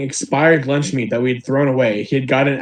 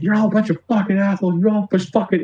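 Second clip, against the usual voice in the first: higher-pitched mock yell without volume; retold screaming rant; 6 semitones higher, faster than usual. Homeless man cursing at everyone while leaving, mock screamed rant. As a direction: imitate a man hurling abuse as he leaves. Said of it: "just continuously screaming".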